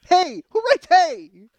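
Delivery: very high, loud squawk of disbelief; drawn-out, shrieked incredulity; incredulous outburst. Real, not acted. Comedian mocking how the ad opens, incredulous outburst. Mocking the ad's opening word.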